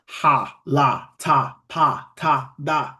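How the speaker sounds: each syllable ending in a plain ah; ha, la, ta, pa, ta, da spaced out. Shown as a demonstration. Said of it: "So it'll be like".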